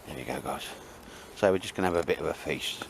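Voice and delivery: usual speaking voice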